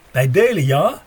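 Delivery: put-on a light German accent, eager and offering. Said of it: "met een zwaar Duits accent".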